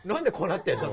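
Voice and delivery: loud, high, half-laughing mock bewilderment; baffled outburst. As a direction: blurt it out as a confused viewer. Acting out a baffled viewer. (笑い混じりに大げさに戸惑う声)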